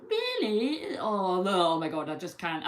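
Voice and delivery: irritating woman's voice. Retold or imitated. Reader mimicking a woman she finds irritating, mocking put-on voice. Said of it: "her voice would get right on my tits".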